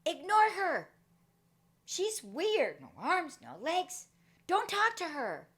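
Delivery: put-on high, loud sneering kid; a taunting schoolyard bully; mean bully. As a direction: jeer to turn the others against her. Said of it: "he says".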